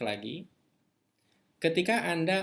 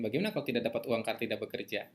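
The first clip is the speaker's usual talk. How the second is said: fast, rattled-off fear; 3 semitones lower, faster than usual. Retold or imitated. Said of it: "Ada ketakutan macam".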